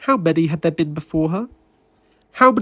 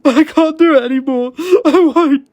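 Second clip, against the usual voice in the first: high, loud, tearful refusal; sobbing refusal; 7 semitones higher, 6 dB louder than usual. A narrator's reading. David refusing through tears, face in his hands.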